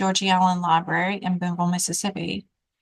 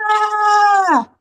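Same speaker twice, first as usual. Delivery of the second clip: alarmed cry; 10 semitones higher, 4 dB louder than usual. Acted. Gerald panics at the bird.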